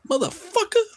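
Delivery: high shocked yelp of a curse; startled curse. Retold or imitated. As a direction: blurt it out in alarm. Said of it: "[name]'s like".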